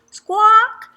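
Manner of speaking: high, loud rising squawk; toucan's shrill squawk; loud bird squawk. A narrator's reading. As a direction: screech like a parrot. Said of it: "squawked the toucan".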